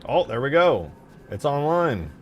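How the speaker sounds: loud, high-pitched real excitement; triumphant. Real, not acted. Coder seeing the generator come online at last, triumphant. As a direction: call it out as it finally works. Script finally works, relief.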